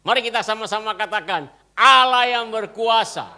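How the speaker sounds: loud, hammering pulpit proclamation; full-force preaching. Acted. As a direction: drive each line home to the congregation. (khotbah lantang penuh semangat)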